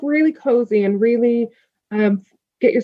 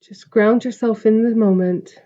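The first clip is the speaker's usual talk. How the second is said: calm, spacious meditation delivery; guided meditation. Acted. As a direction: guide the listener gently into stillness, stretching the breath cues. Leads a meditation.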